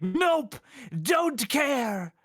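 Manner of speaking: a hammed-up, shouting brush-off; mock shouting gamer. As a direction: yell it like an angry fan dismissing the game. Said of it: "They're like".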